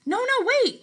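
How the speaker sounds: high, urgent plea to stop. Acted. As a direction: cry out to make him wait. Luke stops Dad turning radio off.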